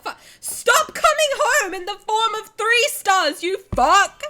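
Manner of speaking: half-laughing, loud and high outraged scream; furious yell. Real, not acted. Streamer yells at the game over three stars, laughing fury. Yelling at bad gacha pull.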